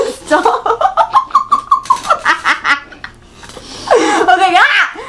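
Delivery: giggling through it; laughing. Real, not acted. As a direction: laugh while saying stop. Laughing with water in mouth.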